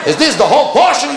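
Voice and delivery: high outraged complaint building to a shout; imitating a screaming customer. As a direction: rage at the tiny cake slice. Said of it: "He's screaming".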